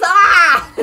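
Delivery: sudden high, loud yelp; retold scream. Retelling a gif to friends, loud high scream. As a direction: let out a short startled scream. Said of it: "he goes".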